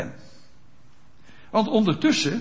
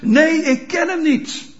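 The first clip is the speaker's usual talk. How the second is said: high, slow, acted shout; Peter's hammed-up outcry; shouted denial; 10 semitones higher, 6 dB louder than usual. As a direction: cry out the denial, drawn out and loud. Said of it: "schreeuwt hij uit".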